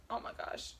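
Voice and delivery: breathy whispered gasp of awe; whispered oh my gosh. Retold or imitated. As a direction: whisper it like the ending floored you. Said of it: "and I was like".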